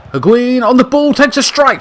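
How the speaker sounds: high, loud building commentary; loud rising play-by-play; excited call. Real, not acted. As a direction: ride the shot with the voice. Shot in live commentary.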